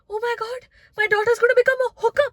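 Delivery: high-pitched aghast mother imitation; horrified mom. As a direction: play a mom appalled for her daughter. Mom's horror at the bar, retold.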